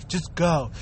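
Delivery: little devil. Retold or imitated. The little devil in his head tempting him, low coaxing voice. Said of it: "that little devil next to you".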